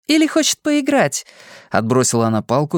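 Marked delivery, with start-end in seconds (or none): 0.1-1.3 put-on higher, playful girlish voice; chirpy young woman teasing; bubbly sorority girl. A narrator's reading. Fortuna voiced, far timbre. (игривая девушка)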